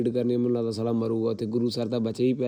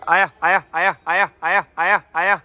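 calling out; 7 semitones higher, 8 dB louder than usual. Real, not acted. Calling pigeons to land.

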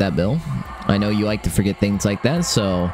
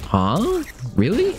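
surprised; 8 semitones higher, much slower than usual. Real, not acted. Learning a surprising game trick.

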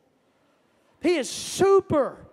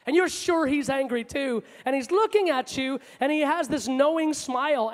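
ordinary talk, nothing marked

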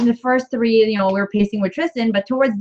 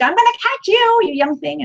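playful mock threat; 10 semitones higher than usual. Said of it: "I'm like".

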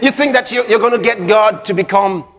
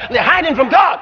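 normal talking voice

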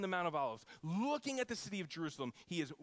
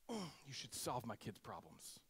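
worried parent plea; 5 semitones lower, 7 dB quieter than usual. Retold or imitated.

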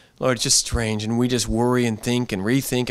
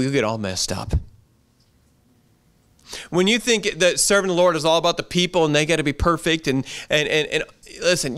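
ordinary talk

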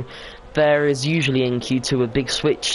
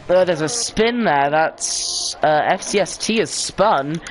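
higher, lively play-by-play of a spin; live race commentary; 4 semitones higher than usual. Real, not acted. Commentator reacts to two cars spinning, live play-by-play. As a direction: call the incident as it happens.

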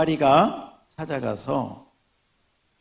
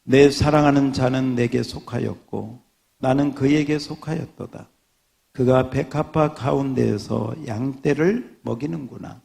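measured, solemn reading of scripture; steady, reverent Bible reading; 3 semitones lower, 4 dB louder than usual. Acted. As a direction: read it like a pulpit lesson. Pastor reads Song of Songs.

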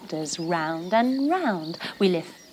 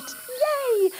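high, delighted cheer; excited cheer. Real, not acted. Arriving at the campsite.

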